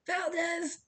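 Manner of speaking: high, loud outraged yell; exasperated coach bellowing a surname; furious coach shout. A narrator's reading. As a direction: shout the culprit's name. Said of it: "the coach slammed down the megaphone".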